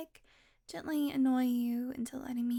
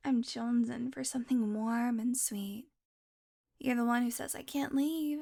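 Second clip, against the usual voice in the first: normal talking voice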